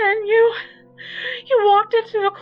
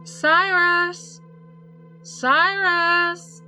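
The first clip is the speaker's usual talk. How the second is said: high, loud, drawn-out call; long, ringing cry for someone; calling a name; 13 semitones higher, 8 dB louder, much slower than usual. A narrator's reading. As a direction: call out from far away, holding the last syllable. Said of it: "calling my name".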